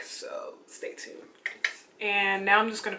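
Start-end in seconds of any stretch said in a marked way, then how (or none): 0.0-1.2 breathy whisper; whispered sign-off. Real, not acted. Vlogger to viewers, whispering before cutting away. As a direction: let them in on a secret.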